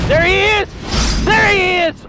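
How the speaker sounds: high, drawn-out alarmed call; alarmed cry. Real, not acted. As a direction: cry out as the enemy appears. Boss appears in the game.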